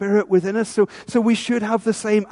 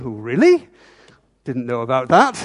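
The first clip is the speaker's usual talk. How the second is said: lower, feigned surprise; mock innocent surprise; 6 semitones lower than usual. Listener reacts to a public rebuke with mock innocence. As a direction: play the congregation pretending not to know. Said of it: "you're going".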